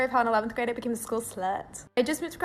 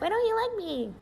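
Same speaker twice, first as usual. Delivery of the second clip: higher, plaintive mock whine; lightly put-on pleading; whiny younger self; 10 semitones higher, slower than usual. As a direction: play your hurt younger self, pitched up. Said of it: "And I'd be like".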